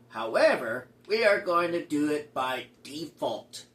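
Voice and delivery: ordinary talk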